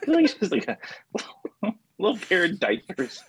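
breaking into real laughter mid-line; laughing. Real, not acted. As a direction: laugh through the words. Laughing at own joke.

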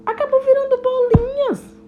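lightly voiced little ladybug, high and giggly at times; a chatty little ladybug telling her tale; hint of a cheerful ladybug girl. Joana the ladybug explains herself.

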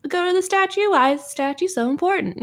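put-on high, whining bored kid; bratty kid complaining about the walk; whiny little girl. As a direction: whine like a tired child dragging her feet. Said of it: "little girl's like".

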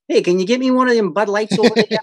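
a cowboy at the bar who opens his mouth and sounds pure Rhode Island; Rhode Island accent. Retold or imitated. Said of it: "the illusion gets broken when you hear them speak".

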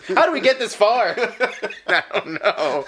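high giggling words; laughing. Real, not acted. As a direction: laugh while you talk. Laughing fit.

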